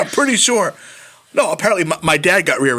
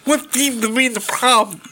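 higher, put-on sketch-hero voice; hammed-up imitation of a sketch character; Handyman; 8 semitones higher than usual. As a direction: play a comic superhero arriving, pitched up. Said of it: "he's like".

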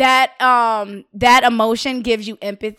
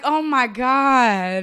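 slow stunned; 3 semitones lower, much slower than usual. Retold or imitated. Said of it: "And I'm like".

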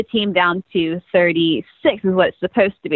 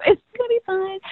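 imitating Rachel's calm voice; 10 semitones higher, 4 dB quieter, faster than usual. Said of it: "I can't do her voice".

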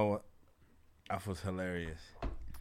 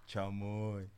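usual speaking voice

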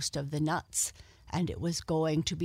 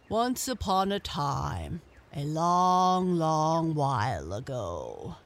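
grave ancient myth telling; 3 dB louder, slower than usual. Acted. Frog begins an old tale.

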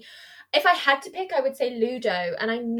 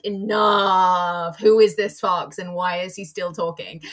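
annoyed; 3 semitones lower, 5 dB louder, slower than usual. Retold or imitated. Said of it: "So I was like".

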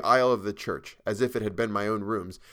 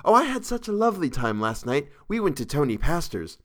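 put-on higher, chatty young woman; light, pitched-up girlish chatter; 5 semitones higher, 3 dB louder than usual. A narrator's reading. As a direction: gush about last night's outing. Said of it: "she began to chatter".

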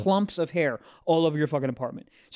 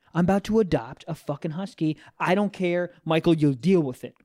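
usual speaking voice

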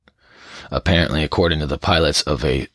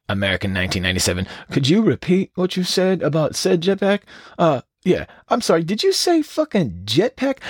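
high, fast, squeaky disbelieving voice; mock tower controller; 11 semitones higher than usual. Imagined controller asking the pilot to repeat, high and squeaky. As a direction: play the controller doubting his ears. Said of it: "It wasn't like".

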